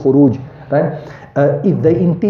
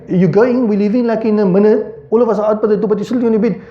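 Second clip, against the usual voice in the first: parent voice pitched higher, chiding; mock nagging parent; 7 semitones higher than usual. Parent to kids still on the bed, high chiding. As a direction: hurry the kids along, exasperated. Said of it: "You tell your kids, like".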